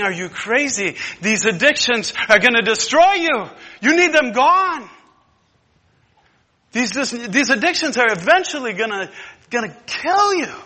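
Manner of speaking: high, loud, urgent rebuke; urgent preaching. Acted. The Lord warning the addict, loud and urgent. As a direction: shout the warning like a alarmed father.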